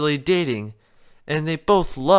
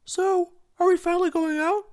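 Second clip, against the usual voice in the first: much higher hopeful Spike; 14 semitones higher than usual. Acted. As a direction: ask hopefully. Spike voiced.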